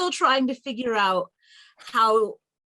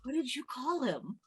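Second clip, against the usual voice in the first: much quieter, confidential question; whispered aside; 10 dB quieter than usual. Acted. Player whispers to Ashawn about the nickname, hushed aside. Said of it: "I whisper to Ashawn".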